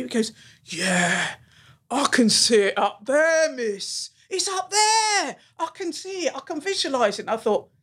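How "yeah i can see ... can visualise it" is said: put-on excited boy. Boy delighted he finally gets it.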